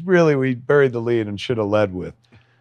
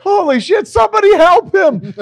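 very high, loud panicked plea; alarmed cry; 8 dB louder than usual. Acted. Viewer panicking at a stranded dinosaur, high shout. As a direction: beg someone to save him. Reacting to the scene again.

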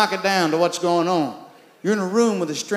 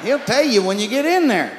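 ordinary talk, nothing marked

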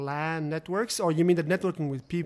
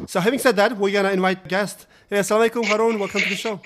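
louder, upbeat show-host greeting; excited host welcome; 3 semitones higher, 8 dB louder, faster than usual. Acted. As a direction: bring the guest on like a radio host. Host loudly welcomes the guest.

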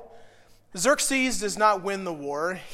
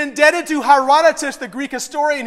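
normal talking voice

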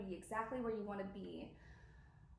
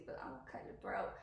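breathy jokey whisper of a threat; mock-sinister aside, whispered; 13 semitones lower, 4 dB quieter than usual. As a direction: drop to a whisper, playfully menacing. Joking threat to Satan.

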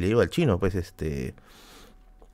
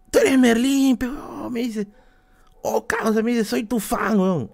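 impersonating the fan; 11 semitones higher, slower than usual. Retold or imitated. Fan's words acted out.

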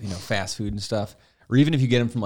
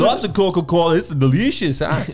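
higher, louder mock Eastern European accent; 8 semitones higher, 7 dB louder than usual. Guest praising Coca-Cola, mock accent. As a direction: gush about Coke in a hammed-up accent. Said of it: "Where's your accent from?".

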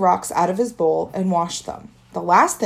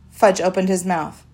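plain reading voice

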